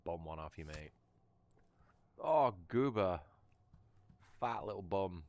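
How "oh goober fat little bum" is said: loud, higher cooing call to the dog; dog greeting. Real, not acted. To his dog Goober, a loud high delighted coo. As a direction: gush over the dog as he walks in.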